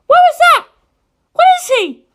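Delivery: very high, loud alarmed cry; shocked and scared girl; frightened outburst. Acted.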